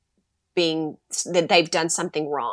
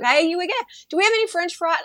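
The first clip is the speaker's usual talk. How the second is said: put-on high, pestering child's voice, much higher; playing the kid who barges in; whiny kid; 10 semitones higher, much faster than usual. Mother imitating kids in bathroom.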